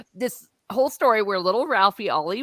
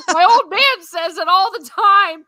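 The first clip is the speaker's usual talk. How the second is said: put-on high, louder kid's innocent blurt; louder, much higher childish answer; 9 semitones higher, 9 dB louder than usual. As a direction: blurt it out like a caught kid. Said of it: "he's like".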